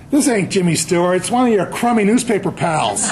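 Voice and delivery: comic retold indignation, punched up; indignant waitress. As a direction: imitate a fed-up waitress scoffing. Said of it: "she stormed off".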